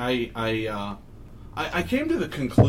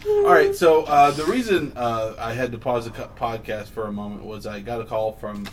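ordinary talk, nothing marked

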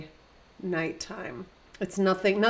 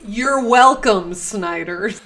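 big you're welcome; 9 dB louder, slower than usual. Pretend. Big mock sign-off.

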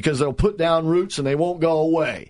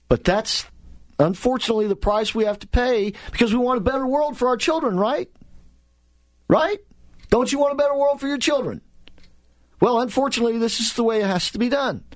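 higher-pitched mock-reasonable pleading; mock liberal; 7 semitones higher than usual. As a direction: lightly put on an earnest justifying voice. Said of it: "of the liberal mindset".

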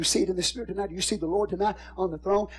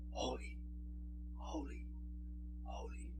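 barely voiced, far softer whisper of holy; whispered praise; 22 dB quieter than usual. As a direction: breathe the holies like a distant heavenly echo. Hear the seraphim in the Spirit.

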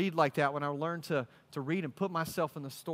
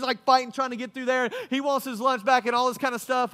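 ordinary talk, nothing marked

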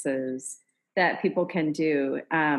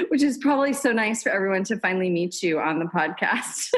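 quick words through laughter; laughing while talking; faster than usual. Real, not acted. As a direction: talk fast, still laughing.

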